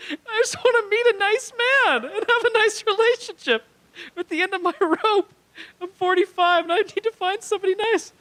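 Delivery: high, loud whiny sob-voice; mock crying woman. Dominatrix crying to the comic about finding a nice man, in a high loud hammed-up wail. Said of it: "she bursts into tears".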